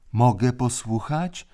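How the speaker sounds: small high voice from the corner; a child pleading from the corner; thin little voice. A narrator's reading. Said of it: "cienki głosik z kąta".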